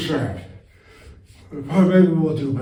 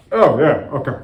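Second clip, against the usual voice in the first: ordinary delivery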